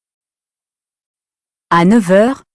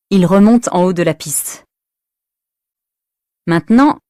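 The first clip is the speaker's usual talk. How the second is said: ordinary delivery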